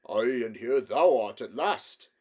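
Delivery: put-on gruff, slow, loud old king; a gruff father scolding; an old king gruffly greets his son. A narrator's reading. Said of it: "said the king in a very gruff voice".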